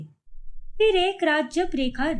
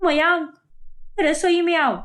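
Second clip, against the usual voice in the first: calling out. Acted. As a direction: call the family in from another room. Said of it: "तुम इतनी जोर से क्यों चिल्ला रही हो?".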